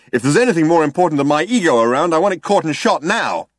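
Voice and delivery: high, loud egotist's boast; puffed-up and pushy, pitched well up; egotistical Zaphod, indignant. A narrator's reading. Zaphod defends his ego to Trillian, loudly self-important.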